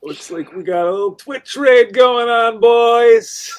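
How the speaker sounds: high, loud hype call; excited announcement. Real, not acted. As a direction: shout it out like breaking news. Announcing an incoming Twitch raid.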